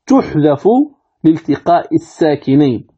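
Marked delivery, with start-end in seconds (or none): none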